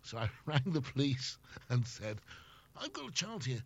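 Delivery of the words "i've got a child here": high-pitched, indignant retold plea; acted-out outrage over noise, pitched high; exasperated complaint. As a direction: protest like a parent at wit's end.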